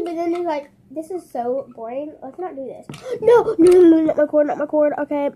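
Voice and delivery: ordinary talk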